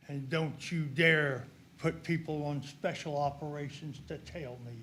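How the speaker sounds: low, hushed threat; quiet warning. Real, not acted. Man warning officials against having him tailed, quiet and threatening. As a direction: drop pitch and volume to warn them off.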